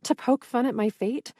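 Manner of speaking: ordinary delivery, nothing marked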